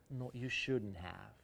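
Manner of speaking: "No, you shouldn't have" spoken slowly with a rising "shouldn't"; a slow, gently touched thank-you; slow polite thanks. Shown as a demonstration. Teacher shows the polite way to say thank you. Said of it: "Ой, спасибо, мне очень приятно".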